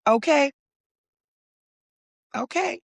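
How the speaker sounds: impressed. Real, not acted. To viewers, impressed by a forty-one-year-old champion, high rising okay. Reacting to her age.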